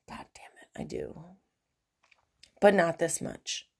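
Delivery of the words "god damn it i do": a breathless whisper, barely voiced; whispered confession. Real, not acted. To her listeners about her boyfriend, whispered reluctant admission. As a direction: let the admission slip out under the breath. Confessing she still loves him.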